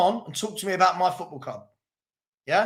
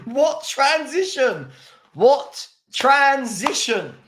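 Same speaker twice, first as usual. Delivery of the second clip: mocking disbelief; 6 semitones higher, 4 dB louder, much slower than usual. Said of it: "repeated mockingly".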